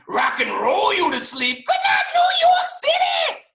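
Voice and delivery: high, loud concert yell, building; loud shout climbing very high, stretched out at the end; loud rock-star shout. A narrator's reading. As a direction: yell it like a rock singer greeting a crowd. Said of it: "It's kind of loud".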